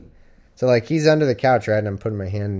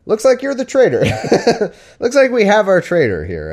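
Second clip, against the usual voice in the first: hammed-up king, much higher and louder; mock king accusing; 10 semitones higher, 6 dB louder than usual. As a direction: gloat over the traitor like a mock king. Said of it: "I'd be like".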